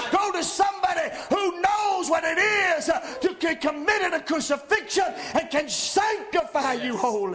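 loud, high shouted preaching; preaching at full force. Acted. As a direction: drive it home like a fiery preacher. Pastor exhorts congregation.

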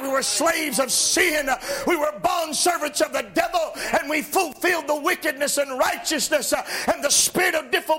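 rising, shouted gospel preaching; preaching at full force. Acted. Preacher to his congregation, shouting, fervent. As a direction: lift the congregation with fiery, shouting delivery. Preacher rises again on liberty.

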